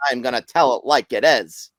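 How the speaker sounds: put-on deliberate old-time sportscaster sign-off; punched, measured announcer delivery; Howard Cosell impersonation. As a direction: sign off with emphatic broadcaster cadence. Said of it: "I'm gonna do another impersonation".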